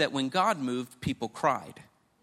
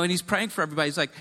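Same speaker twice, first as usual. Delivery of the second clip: ordinary talk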